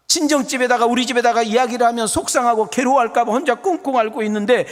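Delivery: high-energy sermon appeal; impassioned preaching. Real, not acted. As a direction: press urgently through each phrase. Preaches at full force again.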